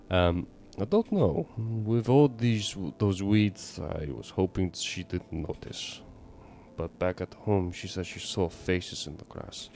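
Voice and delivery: put-on neighbor's troubled confiding in a Russian accent; bad Russian accent. Neighbor worries daughter saw faces, bad Russian accent. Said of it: "bad Russian accent right now for your neighbor".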